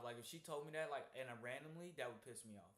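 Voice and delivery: normal talking voice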